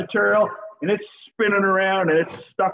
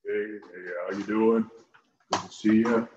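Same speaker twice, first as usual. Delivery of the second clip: much lower, softer, put-on deep voice; deep macho voice; 9 semitones lower, 5 dB quieter than usual. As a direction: play the smooth tough guy saying hi. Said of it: "you like to have that deep voice".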